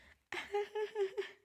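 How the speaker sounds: high, tinkly feminine laugh; a polite, pretty little giggle; a high, dainty demo giggle. Shown as a demonstration. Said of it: "you need to laugh like this".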